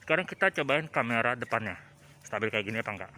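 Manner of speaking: muffled behind a thick mask; through a face mask. Real, not acted. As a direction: talk through a two-layer mask. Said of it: "ini saya pakai masker, jadi suaranya agak ketutup ya".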